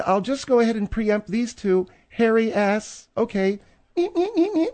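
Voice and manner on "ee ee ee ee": high monkey chatter, ee-ee-ee; monkey sounds. Shown as a demonstration. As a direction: squeal like a little monkey. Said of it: "monkey sounds".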